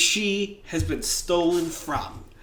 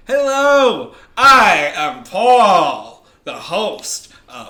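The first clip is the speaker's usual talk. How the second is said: big, drawn-out show-host introduction; 3 semitones higher, 10 dB louder, much slower than usual. Acted. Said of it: "I will come in and I will say".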